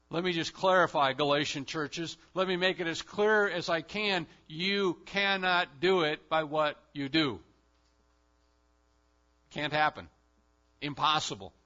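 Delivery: loud, emphatic pulpit voice; full-force preaching. Real, not acted. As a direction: drive it home to the Galatian churches.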